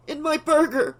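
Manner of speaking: high disgusted cry; panicked outburst of disgust. A narrator's reading. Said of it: "Laura choked out".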